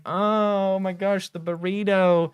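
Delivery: savoring delight. Retold or imitated. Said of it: "And I was just like".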